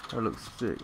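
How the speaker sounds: usual speaking voice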